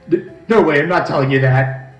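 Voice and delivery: stammering, nervous henchman; flustered goon voice. Acted. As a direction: refuse with rattled bravado. The grunt refuses, flustered.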